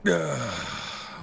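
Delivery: long, high sigh falling away; relieved sigh. Acted. Easing into the hot spring, relieved sigh. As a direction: let out a big sigh of relief.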